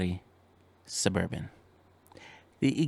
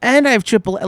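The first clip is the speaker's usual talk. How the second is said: stranded and fed up, throw it out; exasperated disbelief; 8 semitones higher, 4 dB louder than usual. Retold or imitated. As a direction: disbelief boiling over about the triple A. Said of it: "I'm like".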